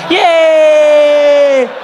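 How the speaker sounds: high, loud joyful yay; kids cheering. Retold or imitated. Kids cheering at the news, loud and high. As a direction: cheer like an excited child.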